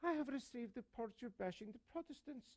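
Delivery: high, soft-spoken Irish archbishop; Irish accent. Acted. Archbishop reprimands a priest by phone, Irish accent. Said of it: "with his Irish accent".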